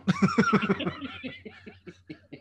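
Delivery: high, loud cackle tailing off; long laugh. Real, not acted. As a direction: burst out laughing at your own panic. Cracks up after acting the panic.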